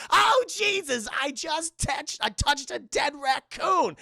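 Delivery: high, loud mock horror; hammed-up grossed-out wail; disgusted shout. Dwarf crawling through vent, yelling in disgust.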